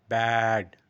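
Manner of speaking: ব্যাড loud with a long, held vowel; stretched BAD. Shown as a demonstration. Teacher showing learners the stretch, long ব্যাড. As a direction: draw out ব্যাড loudly. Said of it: "এটা stretch করছি".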